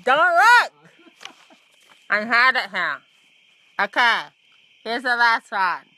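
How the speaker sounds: ordinary talk, nothing marked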